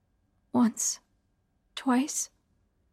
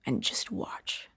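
creepy man voice; 5 dB quieter than usual. Acted. Killer's quiet command.

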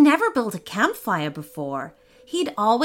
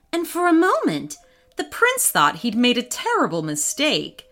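usual speaking voice

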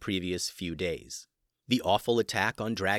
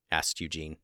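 plain reading voice

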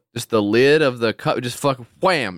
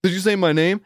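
higher, perky gadget voice; mock eager assistant; 9 semitones higher than usual. Mocking the voice assistant perking up, higher eager voice. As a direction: play the overeager speaker. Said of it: "and it's like".

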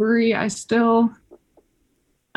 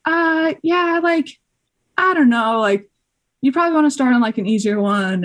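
hesitant advice; 7 semitones higher than usual. Retold or imitated. Climbing team steering a newcomer to an easier route, hesitant. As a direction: imitate someone gently talking a beginner down.

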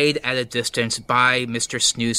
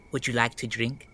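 creepy quiet offer; 8 dB quieter than usual. Acted. Stir fry offers goblin blood.

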